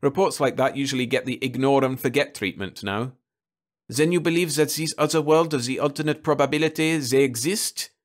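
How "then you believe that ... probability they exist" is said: put-on French accent. Stranger asking about other worlds, French accent. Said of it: "The French accent of a Greek waiter".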